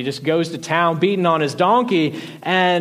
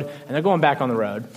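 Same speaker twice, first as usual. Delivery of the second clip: ordinary talk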